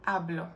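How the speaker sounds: hablo with the stress on the first syllable "ha-"; penultimate-syllable stress. Shown as a demonstration. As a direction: lean on the opening vowel of "hablo". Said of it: "falls on the penultimate syllable".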